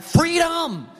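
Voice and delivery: the runaway dog bolting out the back door; dog's joyful cry. Retold or imitated. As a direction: voice the escaping dog, high and giddy.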